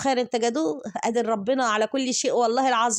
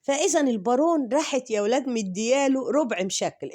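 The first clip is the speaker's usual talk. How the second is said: ordinary talk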